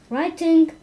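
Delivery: put-on children shouting out together; scholars crying out. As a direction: yell the answer as a class. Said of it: "cried the scholars".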